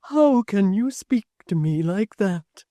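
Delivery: hurt, pleading question; wounded protest at her friend; gentle, hurt plea; plaintive cry. A narrator's reading. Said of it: "cried Miss Tox".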